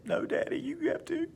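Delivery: put-on whispered no, then a very high small-child voice; little boy voice. Son telling dad he must pray, high little-boy voice. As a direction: play a tiny kid who insists.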